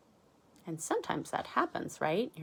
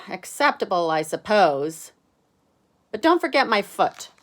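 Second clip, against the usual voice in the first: Arthur's voice; 2 semitones higher, 10 dB louder than usual. Acted. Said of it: "Arthur says".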